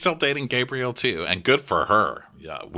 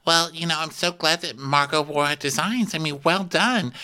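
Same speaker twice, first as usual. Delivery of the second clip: mock gossip, lightly higher voice; Anya; 7 semitones higher than usual. Anya gossiping at the bar, mock gushing. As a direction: gush in a raised, put-on voice. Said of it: "Anya's like".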